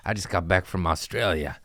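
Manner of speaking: usual speaking voice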